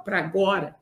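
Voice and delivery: low, soft first take; quiet. Real, not acted. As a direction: say it softly, almost to yourself. Repeats his team's answer.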